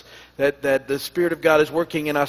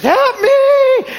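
put-on high, loud child crying help; pleading toddler voice; 9 dB louder than usual. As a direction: beg like a desperate little kid. Imitating grandson crying for help.

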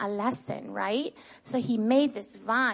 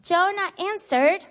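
loud, clear leading call for the class to echo; call-out for children to repeat; 7 semitones higher, 5 dB louder than usual. Shown as a demonstration. Teacher calling the verse for children to echo back. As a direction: lead the kids' recitation, calling each phrase out. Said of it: "we're gonna say the Bible verse together".

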